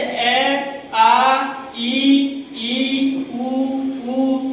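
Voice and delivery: "ए, आ, ई, ई, उ, ऊ" each held as a separate Hindi vowel; vowel sounds demonstrated. Shown as a demonstration. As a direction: recite "ए, आ, ई, ई, उ, ऊ" slowly, one sustained sound at a time. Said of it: "ये होता है इसका उच्चारण".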